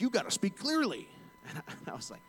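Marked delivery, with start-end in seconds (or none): 0.0-1.1 high, loud scolding; angry caller. Retold or imitated. Angry caller scolding a bank agent on the phone, irate. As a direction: imitate an irate customer telling off the agent. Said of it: "he was so angry".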